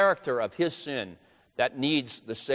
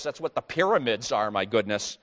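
ordinary talk, nothing marked

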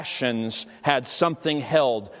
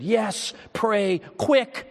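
higher, pressed and hurried; urgent plea; 7 semitones higher, much slower than usual. Retold or imitated. Dying boy urging the chaplain to pray, urgent. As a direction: beg for it before time runs out.

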